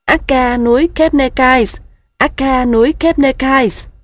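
high, loud plaintive gosling cry; pitiful goslings. A narrator's reading. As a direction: call out like a tired little goose. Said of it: "Các ngỗng con kêu lên, giọng đáng thương". (giọng ngỗng con đáng thương)

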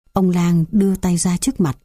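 ordinary delivery

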